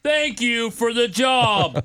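very slow mocking imitation; exaggerated slow-talker impression; mimicking co-worker. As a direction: drag out the thanks. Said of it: "That's how you sound".

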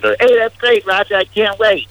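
high, hammed-up eager voice; mock coworker voice. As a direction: gush with fake excitement. Mock voicing coworker Roger.

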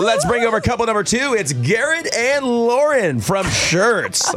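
slow, high game show host announcement. Pretend. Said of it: "That's my game show voice".

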